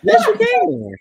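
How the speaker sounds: insistent high yes you can. Real, not acted. Host teasing guest to yell her phrase, high insistent.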